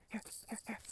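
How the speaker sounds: breathy whispered call to the puppy; soft whispered call. Real, not acted. Calling dog back softly.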